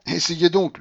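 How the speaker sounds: high, loud comeback; challenging retort. A narrator's reading. Companion answering the frantic general, challenging. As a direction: dare them to try.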